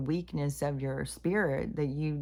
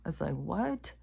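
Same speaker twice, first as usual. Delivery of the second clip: normal talking voice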